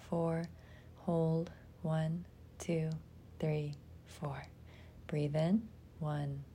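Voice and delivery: ordinary delivery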